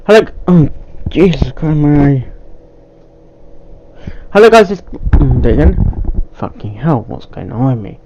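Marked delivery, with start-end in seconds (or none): none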